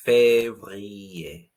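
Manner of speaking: février with the R rolled in the throat; février, R scraped from the back of the throat; février with a guttural French R. Shown as a demonstration. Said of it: "The R sound comes from your throat".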